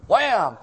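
a loud, laughing "Wham!" shouted out; mock wham. Retelling Bruce bumping the new lady, loud mock wham. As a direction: burst it out like a collision. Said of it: "and he's all".

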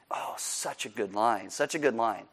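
low, soft, savouring aside; quiet admiration. Real, not acted. Savouring a quote just read.

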